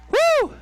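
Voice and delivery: high, loud woo; excited whoop. Real, not acted. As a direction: let out a big cheering whoop. Cheering the married couple.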